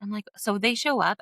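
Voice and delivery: usual speaking voice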